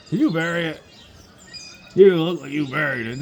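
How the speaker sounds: ordinary talk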